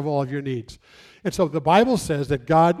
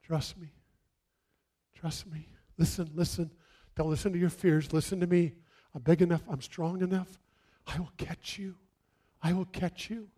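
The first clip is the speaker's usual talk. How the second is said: much softer, hushed reassurance; God reassuring; 9 dB quieter than usual. Acted. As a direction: speak gently, as if to a frightened child. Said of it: "And God says".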